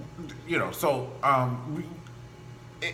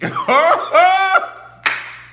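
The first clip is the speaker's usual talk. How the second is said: high loud cackle; laughing at her own joke; 13 dB louder than usual. Real, not acted. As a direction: burst out laughing. Big laugh.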